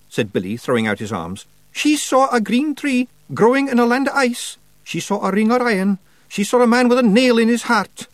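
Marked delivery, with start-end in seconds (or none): 1.7-8.1 high, loud Feegle voice; grave Scots Feegle. Acted. Feegle tells witch of prophecy, urgent. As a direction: recount a dire dream, high and urgent.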